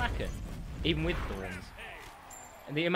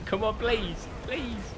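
desperate plea; 8 semitones higher, slower than usual. Real, not acted. Begging for the kill.

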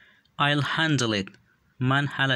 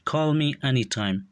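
each word said slowly and distinctly for learners; slow and clear; 2 semitones higher, slower than usual. Shown as a demonstration. Teacher models English phrase for learners, slow and clear. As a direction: model the phrase slowly so students can copy it. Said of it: "به خاطر آموختن تلفظ درستشان".

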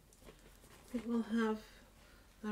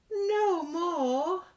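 squeaky mouse; 6 semitones higher, 7 dB louder than usual. Acted. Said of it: "the mouse said".

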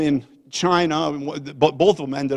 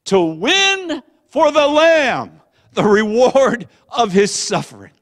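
loud, high, slow proclamation; rallying cry; 8 semitones higher, 10 dB louder, slower than usual. Retold or imitated. Preacher reading son's text back as a rallying cry. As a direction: proclaim it like a battle cry, drawn out.

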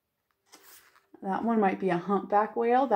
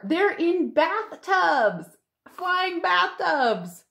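slow, much higher wonder; awed at flying bathtubs; amazed; 9 semitones higher, much slower than usual. Real, not acted. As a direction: stretch it out in delight. Child marvels at picture.

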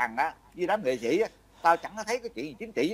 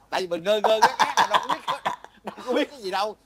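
laughing sheepishly; 7 semitones higher, 4 dB louder, faster than usual. Real, not acted. Laughs admitting he knew nothing.

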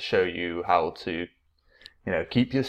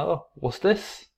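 put-on teacher voice for kids; 9 semitones higher than usual. Shown as a demonstration. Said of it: "I'd get the banana".

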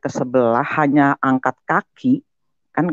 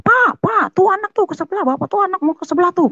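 mother yelling. Retold or imitated. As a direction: shout for her husband in a panic. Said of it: "sampai teriak-teriak sama bapak saya".

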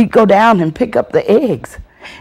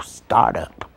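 ordinary delivery

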